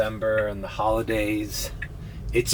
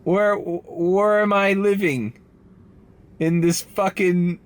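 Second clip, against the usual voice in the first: slow disbelief; 7 semitones higher, slower than usual. Retold or imitated. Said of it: "and I'm like".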